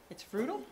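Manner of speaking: FRUTAL rising at the end, like a question; questioning inflection. Shown as a demonstration. Host mimicking the student's announcement back, questioning inflection. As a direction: echo it as a question, voice going up on "frutal". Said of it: "Sounds like a question".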